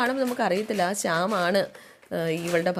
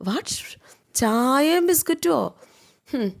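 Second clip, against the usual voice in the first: slow, high-pitched scornful echo; acted sneering incredulity, pitched up; mocking; 5 semitones higher, slower than usual. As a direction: repeat it drawn out in mock disbelief. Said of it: "ശ്രുതിയെ കളിയാക്കി ചിരിക്കുകയാണ്".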